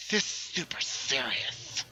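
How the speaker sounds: slow, drawn-out put-on voice; cartoon character impression. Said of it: "That's the voice of".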